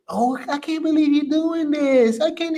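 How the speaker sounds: high-pitched mock whining for sympathy; whiny voice. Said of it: "they put on that voice".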